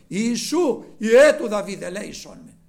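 loud plea fading at the end; repeated cry for mercy; crying out again. Retold or imitated. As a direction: shout it, then let it sink. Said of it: "πολλώ μάλλον έκραζε".

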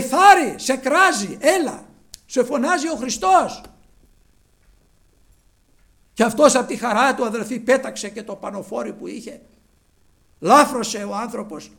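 loud, high, rousing sermon voice; preacher calling out at full voice; full-force preaching. Acted. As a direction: proclaim the good news to the whole congregation.

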